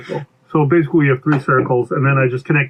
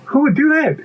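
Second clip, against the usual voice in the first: high-pitched worried question; alarmed pea; 12 semitones higher than usual. Acted. Pea asks who would gobble it up, alarmed.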